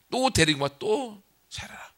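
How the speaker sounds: slow whispered order; whispered command. Acted. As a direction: breathe the order slowly as a whisper. God tells Hosea again. (천천히 속삭이는 명령)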